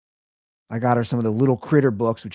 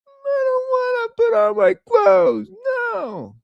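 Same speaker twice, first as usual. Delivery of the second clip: very high, drawn-out mock toddler whine; whining three-year-old; much slower than usual. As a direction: imitate a toddler wailing in protest. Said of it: "or the three-year-old".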